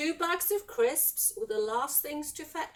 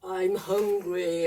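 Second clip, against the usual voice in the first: low, slow dragon voice; a deep, drawn-out storybook growl; roaring dragon; 5 semitones lower, slower than usual. A narrator's reading. Hungry dragon on the steeple, roaring. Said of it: "it roared".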